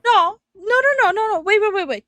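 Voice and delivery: rapid high, loud no's; high alarmed protest. Real, not acted. Streamer objecting to the comment in high alarmed protest. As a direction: cut in fast, alarmed and high.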